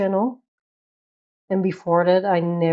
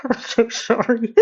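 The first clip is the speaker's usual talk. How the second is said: laughing apology; 4 semitones higher, 3 dB louder than usual. Real, not acted.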